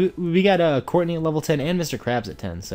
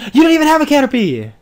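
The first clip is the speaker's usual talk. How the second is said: loud, high, fast indignant rant; indignant outburst; outraged; 11 semitones higher, 6 dB louder, faster than usual. Real, not acted. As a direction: rail at the unfair trainer. Outraged at trainer's team.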